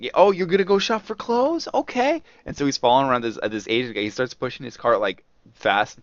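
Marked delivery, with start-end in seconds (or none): 0.0-2.2 mock disbelief, pitched high; sarcastic guard. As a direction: sneer at the thief's excuse. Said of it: "And so he's like".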